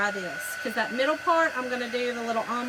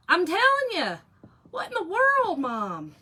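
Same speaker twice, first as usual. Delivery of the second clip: exasperated outburst; 8 semitones higher, 6 dB louder than usual. Real, not acted.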